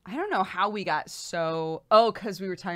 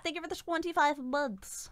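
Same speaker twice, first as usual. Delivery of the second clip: silly thanks; 9 semitones higher, 4 dB quieter than usual. Pretend.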